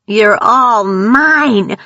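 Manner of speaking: very slow, high, loud monstrous cry; inhuman screech. A narrator's reading. Grace gloating over her victims, slow inhuman screech. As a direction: stretch it out like a predator gloating. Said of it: "Grace screeched".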